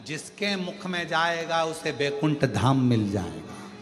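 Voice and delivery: demon Tarka humbly pleading with Shiva for death and union; humble demon pleading. Acted.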